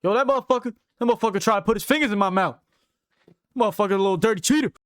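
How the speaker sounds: high, loud, fast mock outrage; hammed-up fighter griping about a dirty cheater; mock outraged complaint. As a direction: rant it like you got cheated. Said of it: "and be like".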